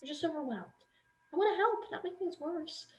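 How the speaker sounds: put-on calm your upset child down; mother hushes her worried daughter softly; soothing.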